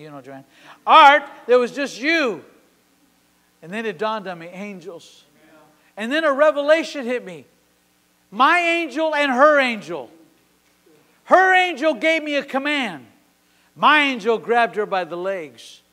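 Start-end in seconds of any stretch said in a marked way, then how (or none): 0.9-2.4 loud, slow, insistent correction; retold yell. Woman yelling at the preacher that nobody was there, retold yell. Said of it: "she yelled at me". 6.0-15.8 loud, high, slowed preaching declaration. Acted. Preacher declaring his angel revelation to congregation, full-force preaching.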